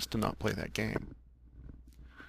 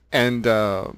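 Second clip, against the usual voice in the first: normal talking voice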